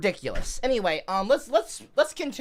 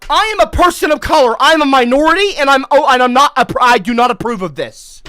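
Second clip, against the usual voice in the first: mock outrage; 4 semitones higher, 8 dB louder than usual.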